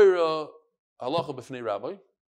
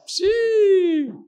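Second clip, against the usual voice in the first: long, loud, high "pshee"; impressed exclamation; 7 dB louder than usual. Real, not acted. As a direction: let out a high drawn-out sound of amazement. Marvels at the visitor.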